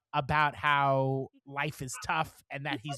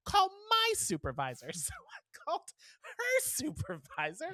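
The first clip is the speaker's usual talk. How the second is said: laughing disbelief. Real, not acted. Exasperated laugh at voicemail loop.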